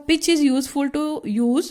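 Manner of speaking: reading the textbook line out very slowly for notes; slow dictation reading. Shown as a demonstration. Teacher reads the definition to students, slow and deliberate. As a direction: dictate each word slowly so the class can write it down.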